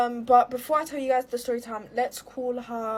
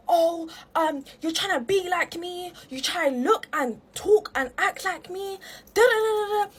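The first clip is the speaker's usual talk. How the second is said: mock raised voice, pitched high; imitating raised voice; 6 semitones higher than usual. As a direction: mimic her rant in a higher voice, trailing into da-da-da. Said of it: "She started raising her voice".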